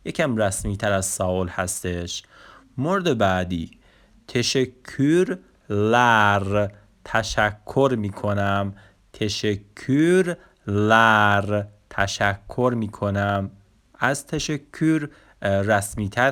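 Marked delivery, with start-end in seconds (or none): 4.3-6.7 تشکر لر said very slowly, syllable by syllable, as teşekkürler; slow Turkish demo. Shown as a demonstration. Teacher models Turkish teşekkürler slowly. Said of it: "اصطلاحاتمون رو به ترتیب بخونیم". 9.1-11.6 تشکر لر said very slowly, syllable by syllable, as teşekkürler; slow Turkish demo. Shown as a demonstration. Teacher models Turkish teşekkürler slowly. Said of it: "اصطلاحاتمون رو به ترتیب بخونیم".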